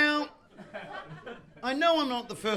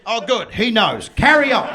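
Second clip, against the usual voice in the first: mock approval; 8 dB louder than usual. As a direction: grant him leave to continue. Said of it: "and you went".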